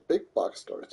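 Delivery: ordinary talk, nothing marked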